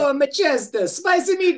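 high cartoon Italian accent, hammed up. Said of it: "could you do that in an Italian accent".

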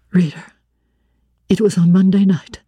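plain reading voice

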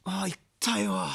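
whispered, wincing under the breath; pained whisper. Retold or imitated. Senior comedian whispering about his torn abs, pained whisper. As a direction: breathe it out like it hurts. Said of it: "っつって".

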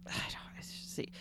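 whispered disgust. Real, not acted. Friend whispering disgust at Burning Man dust. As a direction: whisper it with distaste.

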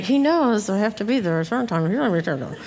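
mock muttering, trailing into a mumble; under-the-breath grumbling. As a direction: grumble to yourself about the husband. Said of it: "And under my breath, I'm going".

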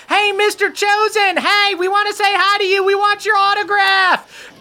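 put-on very high-pitched kids clamoring; high, eager kiddie voice; excited youngins. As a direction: squeal it like pestering young fans. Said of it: "a bunch of them start banging on the door".

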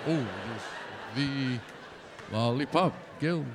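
low, dopey munchkin impression; Lollipop Guild voice. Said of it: "that Lollipop Guild guy".

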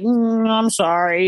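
slow, drawn-out sheepish dog voice; mock-remorseful dog, stretched out; apologetic dog. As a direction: draw out a guilty puppy's apology. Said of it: "and he's like".

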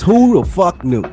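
ordinary delivery

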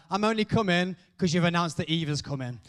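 normal talking voice

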